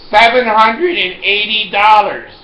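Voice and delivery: high, loud, dramatic reveal; loud price announcement. Retold or imitated. Pharmacist breaking the price to a customer, loud announcement. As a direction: belt out the price for comic shock.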